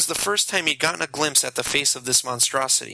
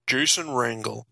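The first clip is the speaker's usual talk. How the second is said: a lightly voiced inhuman vampire; a hint of something not human; the vampire greeting Jason, lightly inhuman. A narrator's reading. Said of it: "Brian said in an inhuman voice".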